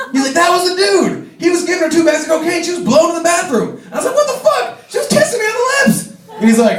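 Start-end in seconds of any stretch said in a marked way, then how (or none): 0.3-3.8 high, shouty reveal; pitched-up gossiping friend; excited gossip. Retold or imitated. Patrick reveals gossip loudly. 4.2-6.1 very high, shouted disbelief; appalled high-pitched yelp; shocked outburst. Retold or imitated. Shocked at learning truth.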